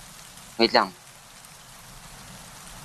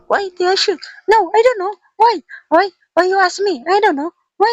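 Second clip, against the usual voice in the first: much higher, louder squealing panic; frantic whine; 4 dB louder than usual. Real, not acted. Gamer panicking over his own shot, high squealing whine.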